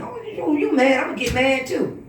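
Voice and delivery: high, breathy mimic of a petty comeback; mocking quote. Mocking the woman who gets mad because her man is mad. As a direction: sneer it back like a copycat.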